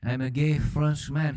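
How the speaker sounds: proud mock-French announcement; cartoon Frenchman; fake French accent. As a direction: introduce yourself in a hammy French accent. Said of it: "That was a fake accent".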